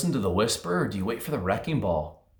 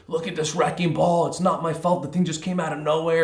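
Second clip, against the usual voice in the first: higher, louder whiny victim voice; mock self-pitying blame, higher and louder; playing the victim; 8 semitones higher, 3 dB louder than usual. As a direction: whine like it's not your fault. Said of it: "start becoming the victim and just pointing fingers".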